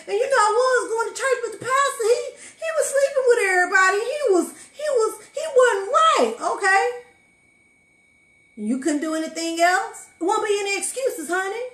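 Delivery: full-force preacher shout, breaking into sobs; preaching at full force. Acted. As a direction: preach it like a Sunday revival, near crying. Shouted church testimony.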